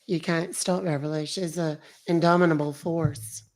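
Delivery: lightly put-on character voice; hint of a sweetly proud character; Beverly voice. Streamer echoing a comment about Beverly, in a light character voice.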